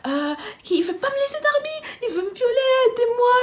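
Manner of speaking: high, loud imitated wail for help; tearful yelling. Begging everyone for help, crying and yelling. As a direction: plead in a hammed-up crying voice. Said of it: "je commence à pleurer".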